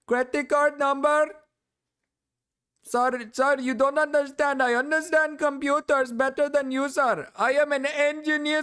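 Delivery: put-on high, pleading Indian call-center accent; mock Indian scam-caller accent. Mocking the streamer as an Indian scam caller, high put-on Indian accent.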